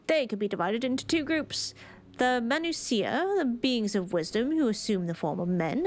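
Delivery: put-on light British accent, formal islander. Islander explaining the gods, in a light British accent. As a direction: lay on a polite British accent. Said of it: "gave this random one a British accent".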